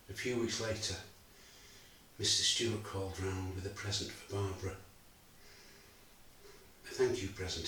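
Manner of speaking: quiet, measured, heavy with loss; a father recalling betrayal and his wife's death; grieving father's monologue. Acted. A father telling the audience of loss, subdued and grieving. Actor performs Bob's final monologue.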